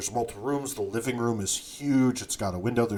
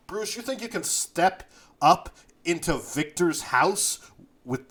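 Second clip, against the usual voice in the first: higher-pitched, incredulous taunting; scornful disbelief; 6 semitones higher, 4 dB louder than usual. Acted. Ironclad challenging Bruce over his crew, incredulous and scornful. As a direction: scoff at them, voice riding high.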